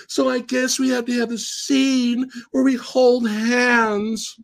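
put-on high, slow, wide-eyed girlish voice; little girl voice. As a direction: play a sweet captive girl, slowly. Girl quote continues after interruption.

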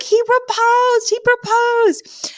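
very high, slow, squealing he proposed; giddy high-pitched squeal, stretching each word; excited Fran. Retold or imitated. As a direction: squeal it out like breathless big news. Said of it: "Fran comes running in".

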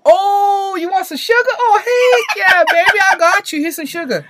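very high, loud mock Southern belle; squealing Southern lady impression; delighted Southern lady. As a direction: burst into gushing, hammed-up recognition. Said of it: "the lady's tripping out".

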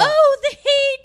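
high, loud melodramatic wail; hammed-up damsel cry; mock swooning cry. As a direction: cry out in overblown distress. Mimics Elizabeth's fainting act.

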